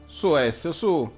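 urging on. Real, not acted. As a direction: push the character on, excitedly. Player urging character on. (incitamento concitato)

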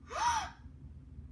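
sharp high gasp of delight; excited gasp. Real, not acted. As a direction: gasp in surprise at the reveal. Unboxing reveals the Shopkin.

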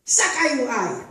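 high, loud bellow of command; the overseer roars it while whipping; roaring order. A narrator's reading. The overseer bellows at Tutuba while whipping her. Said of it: "de Basha brulde".

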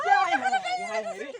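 high, slow, laughing lament; husband laughing and wailing at the chaos. Acted. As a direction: wail through laughter. Comic lament.

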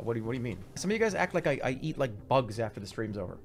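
ordinary talk

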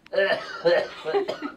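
rough hacking cough; smoker's cough. Real, not acted. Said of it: "A smoker's cough".

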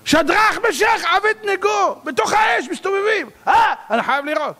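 high-pitched frantic cries to the king; alarmed shouting. Acted. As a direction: shout in astonishment, voice leaping way up. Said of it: "התחילו לצעוק".

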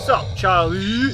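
slow, loud, dudish greeting; odd mock greeting. Player greeting the monster in a goofy dude voice. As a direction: drawl it like a cool guy. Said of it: "Don't know why I said it like that".

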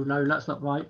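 usual speaking voice

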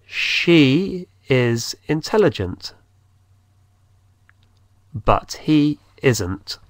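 slow, clear, she stressed; slow dictation pace; slow model. Shown as a demonstration. Teacher dictates slowly to Spanish learners. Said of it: "pero él no es".

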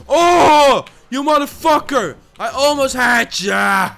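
loud, high cry of outrage; real heated gamer yell; angry outburst. Real, not acted. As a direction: yell at the rival who just got away. Rage at losing in game.

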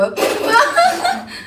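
sudden high giggle; high burst of laughter. Real, not acted. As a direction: break into a squeaky laugh.

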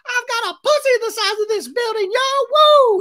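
very high, loud squealed parody; little Lala. Retold or imitated. Mocking Lala's boast, squealing high and loud. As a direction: shriek it in a tiny hammed-up voice. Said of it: "little Lala's like".

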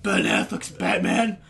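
earnest rasping hero voice; Bat Dad. Acted. Friend muses on Batman casting, Bat Dad voice. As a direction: wish it aloud in a superhero rasp. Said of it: "I was doing Bat Dad".

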